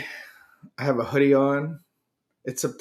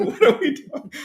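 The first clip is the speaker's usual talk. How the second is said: laughing; 7 dB louder than usual. Real, not acted. Laughing during joke.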